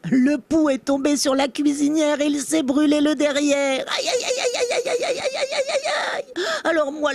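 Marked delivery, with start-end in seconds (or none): 3.9-6.2 high wailing ouch ouch ouch; cries of pain. Acted. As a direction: wail it out high like a hurt bug. Louse burns its behind.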